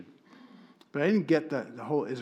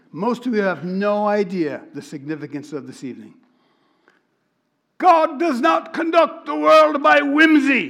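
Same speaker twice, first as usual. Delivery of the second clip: Hitchcock-like rabbi; 8 semitones higher, 10 dB louder than usual. Retold or imitated. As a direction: proclaim it grandly in a British voice. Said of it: "he sounds like him".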